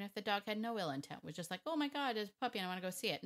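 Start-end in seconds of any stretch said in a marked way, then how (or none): 1.7-3.2 quick, higher-pitched gushing; excited dog. Acted. Trainer voicing a dog spotting a puppy, excited. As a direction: rush it out like an eager dog.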